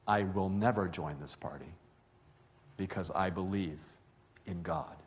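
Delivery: quiet, composed declaration of faith, retold; very calm. Grandmother answering the shouting official, very calmly. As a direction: answer a shouting man with calm, unhurried firmness. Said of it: "able to say very calmly".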